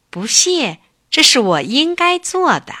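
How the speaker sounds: a humble cub brushing off thanks; modest little bear. Acted. Little bear answers the grateful bird, lightly voiced modest cub.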